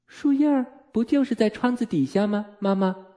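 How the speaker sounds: put-on high-pitched little girl questioning her mother; child Wendy. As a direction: raise the voice into a young daughter's. Narrator voices child questioning mother. (小女孩的高音调)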